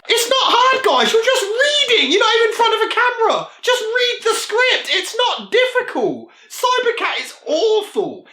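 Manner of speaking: a fed-up critic yelling at the actors; exasperated rant. Real, not acted. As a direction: plead at them in disbelief.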